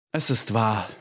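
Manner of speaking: mildly muffled, subdued admission; dull, hollow voice. A narrator's reading. Markheim admits the visitor is right, in a dull voice. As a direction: concede it in a dull voice. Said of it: "sagte Markheim mit dumpfer Stimme".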